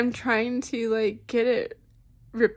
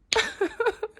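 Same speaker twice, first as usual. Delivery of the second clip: teary laugh; 10 semitones higher than usual. Real, not acted. Laugh breaking into tears.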